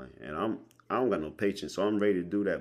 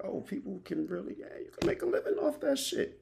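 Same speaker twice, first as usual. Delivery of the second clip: amazed realization; faster than usual. Retold or imitated. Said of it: "I was like".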